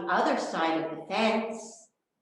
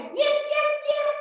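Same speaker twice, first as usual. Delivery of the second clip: fox yips; 5 dB louder than usual. Acted. Little fox calls toward the music, yipping high. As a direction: call out like a small fox, much higher. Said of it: "Little Fox called again".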